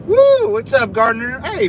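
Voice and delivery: woo shouted high and loud; hyped shout-out to chat; excited greeting. Real, not acted. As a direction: greet a viewer with a burst of excitement.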